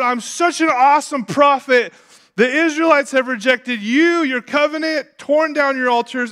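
high, loud aggrieved outburst; snapping at God. Acted. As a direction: shout an indignant complaint to heaven. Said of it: "He snaps at God".

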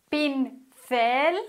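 pincel, stressed on the last syllable cel; Spanish example word. Shown as a demonstration.

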